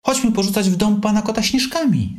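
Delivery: high, eager call to friends; excitedly rounding up friends. A narrator's reading. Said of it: "zawołał". (wysokie, ochocze wołanie)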